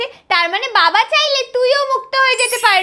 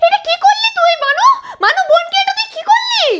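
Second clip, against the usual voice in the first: high, raised-voice outcry; alarmed scolding; 8 semitones higher than usual. Acted. Parent confronts genie over transformed sister, alarmed scolding. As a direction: cry out in dismay, much higher.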